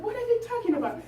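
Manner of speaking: put-on high-pitched puzzled child; little boy. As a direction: ask like a baffled small boy. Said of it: "there was a little boy".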